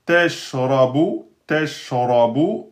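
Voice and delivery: Teshrabu read slowly, each syllable apart; teacher reading a new word aloud; slow model reading. Shown as a demonstration. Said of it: "teacher models word".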